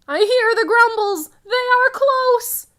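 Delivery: put-on alarmed child, slowing on they are close; scared. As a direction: sound like the monsters are right outside. Scared children hearing monsters.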